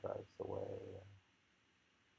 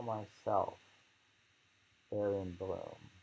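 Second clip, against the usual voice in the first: poem reading; 3 semitones higher, 6 dB louder than usual. Acted. Reads poem, high and loud.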